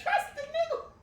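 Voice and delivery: reading it out while cracking up; giggling quote. Real, not acted. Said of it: "I can't wait now".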